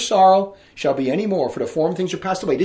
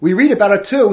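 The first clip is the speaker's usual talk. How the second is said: usual speaking voice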